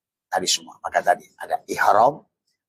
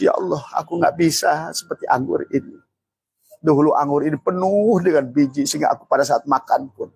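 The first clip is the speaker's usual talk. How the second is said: pleading prayer voice; 7 semitones higher than usual. Retold or imitated. Voices the same prayer with grapes.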